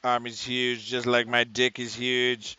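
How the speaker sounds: slow, boastful mock Trump drawl; Trump impression. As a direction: puff up like a bragging president. Mocking Trump voice.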